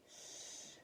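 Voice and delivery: whispered shush; sharp whispered shh; hissed. A narrator's reading. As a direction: hush the others urgently. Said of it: "hissed Pip".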